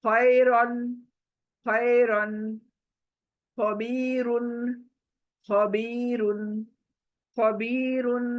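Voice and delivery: खैरन with a full, thick र; heavy ra. Shown as a demonstration. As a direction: correct take of खैरन, र heavy. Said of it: "रन नहीं". (खैरन में र मोटा)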